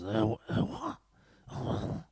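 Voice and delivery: smoker's reality show announcer, calling "Erwan"; Big Brother voice. Retold or imitated. Imitating the French Big Brother voice calling a contestant. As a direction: rasp it like fifty Gitanes an hour. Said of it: "the Big Brother voice, like he'd smoked fifty gitans".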